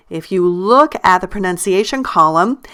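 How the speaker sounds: normal talking voice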